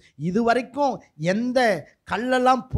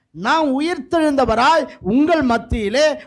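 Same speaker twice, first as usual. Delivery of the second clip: high preaching voice swelling louder; impassioned proclamation; 7 semitones higher, 4 dB louder than usual. Acted. Preacher voicing the risen Lord among his people, impassioned proclamation. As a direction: proclaim it, stretching the final words.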